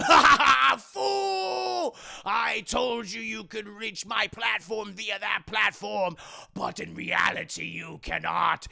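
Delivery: slow, loud, booming evil overlord; gloating villain. Retold or imitated. Villain telling the hero his jump is doomed, slow booming gloat.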